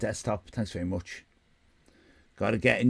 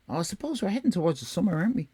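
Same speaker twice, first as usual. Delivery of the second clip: usual speaking voice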